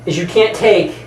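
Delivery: ordinary talk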